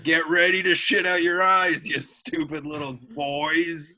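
demon voice. Acted. Demon character taunting boys.

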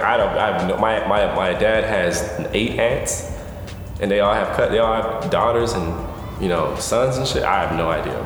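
ordinary talk, nothing marked

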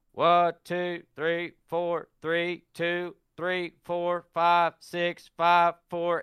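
barked count, high and loud; shouted drill cadence. Retold or imitated. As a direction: call the push-up count like a sergeant. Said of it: "And he'd go".

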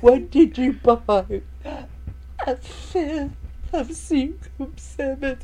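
high, slow mocking mother voice; demonic double voice. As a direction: loom over her like a possessed mom. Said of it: "in the possessed way".

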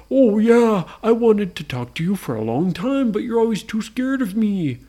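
eager Frankenstein. Acted. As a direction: plead warmly for friendship, loud at first. Frankenstein befriends ghost.

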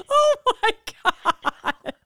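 high, loud squeal through laughing; helpless laughter. Real, not acted. Wife telling salami story to friend, helplessly laughing. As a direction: crack up so the words stretch out slowly.

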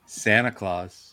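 slow grand announcement; announcer. Acted. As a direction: announce him like a showman. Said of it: "Ladies and gentlemen".

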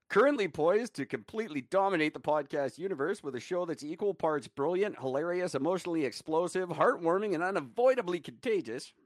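a hint of an announcer reading ad copy; promo read. Host reading his old podcast promo to listeners, mild announcer voice. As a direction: read it like a grand trailer.

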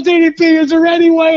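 high, quick eager plea; imitated starstruck cop. Cop asking wrestler for a favor, imitated eagerness. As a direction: beg like an excited fan.

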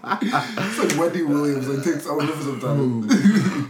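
slow, laughing through words; laughing at a friend. Real, not acted. Laughing comment.

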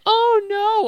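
high-pitched "oh no" squeal; mock dismayed girl. Imitating a cartoon victim to co-host, mock dismay. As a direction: put on a high girlish dismay. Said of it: "the girl's like".

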